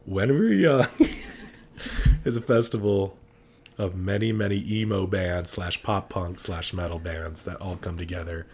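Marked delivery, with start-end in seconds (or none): 0.0-2.2 giggling through the name; laughing. Real, not acted. Laughing while announcing festival.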